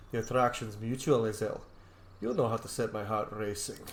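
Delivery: low, soft romantic reply; hushed flirty roleplay voice to Lae'zel; roleplaying a smitten hero. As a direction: murmur it to her like a lover. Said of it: "Let's Roleplay".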